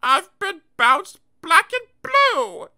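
high, slow sorry little voice; self-pitying character. Acted. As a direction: whimper it out slowly.

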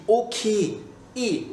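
Scottish single-vowel ending on ok; Scottish accent. Shown as a demonstration. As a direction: say ok as a Scot, no diphthong. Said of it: "En écossais, se dit".

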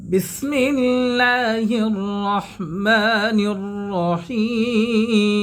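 slow, chanted tajwid recitation; Quran recitation. Acted. Teacher recites the full surah for students to follow, chanted. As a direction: recite it slowly, melodic and held.

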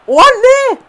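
sudden high loud squeal; startled high yelp; shocked. Real, not acted. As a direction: caught off guard, voice jumping way up.

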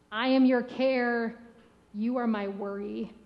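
slow, measured lines trading "I am" and "you are"; poem recital. Acted. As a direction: lay each line down slowly like a poem. Recites his own call-and-response poem.

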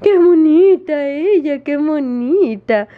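high, slow, drawn-out pet adoration; cooing. Real, not acted. Owner fusses over tortoise before jealous dog, cooing.

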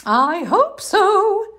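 sunny mother frog greeting; cheery mom voice. Acted. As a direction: greet him like a bright cartoon mom.

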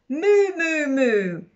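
long moos sliding down from high; hammed-up storybook cow noise; duck pretending to moo. A narrator's reading. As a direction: moo it out playfully, starting high. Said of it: "the duck on the pond said".

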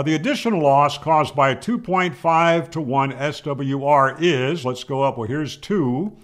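ordinary talk

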